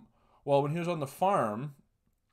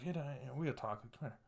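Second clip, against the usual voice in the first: much softer, confidential retold aside; hushed aside; 13 dB quieter, faster than usual. Son pulling Dad aside for a talk, hushed. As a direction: drop right down so the others can't hear.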